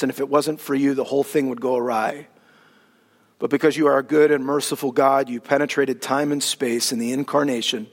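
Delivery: hushed, low pastoral prayer; a pastor praying for the church, low and hushed. Acted. Pastoral prayer before sermon.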